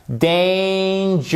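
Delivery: DANGEROUS stretched slowly over three syllables; slow, high teaching demo of DANGEROUS. Shown as a demonstration. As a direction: draw out DANGEROUS, high and held. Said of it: "trois syllabes".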